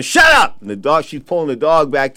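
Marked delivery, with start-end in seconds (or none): 0.0-0.6 high, loud yell at the dog; yelling shut up. Retold or imitated. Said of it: "And the lady's going".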